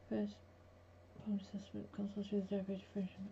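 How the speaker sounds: fast whispered aside. Real, not acted. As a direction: breathe it out, no voice.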